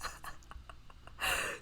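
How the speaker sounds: airless shocked laugh; breathless laugh. Real, not acted. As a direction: laugh without voice after the shock.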